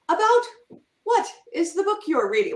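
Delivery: stilted, high-pitched over-correct question; overly proper. Shown as a demonstration. Writer mocking how nobody talks to a friend, stiffly proper. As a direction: say it with mock-proper stiffness, pausing after the first word. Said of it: "Might be proper, but you don't talk like that".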